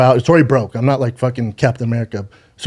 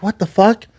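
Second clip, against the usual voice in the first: shocked; 7 semitones higher than usual. Retold or imitated. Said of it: "I'm like".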